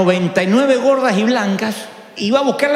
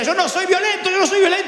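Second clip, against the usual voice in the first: mock agitated denial; 8 semitones higher, faster than usual. Man breaking the desk.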